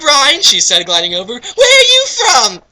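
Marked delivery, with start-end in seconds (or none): 0.0-0.4 put-on high girly squeal of a greeting; high-pitched girl greeting; squeaky girl voice. Girl character greets Herobrine. 1.3-2.6 very high, loud cartoon girl. Voicing girl character Knights.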